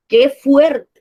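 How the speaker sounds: exclamación mucho más alta y fuerte; suéltalo con sorpresa y emoción; emocionada. Shown as a demonstration. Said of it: "contando todo esto con una emoción". (exclamación emocionada y fuerte)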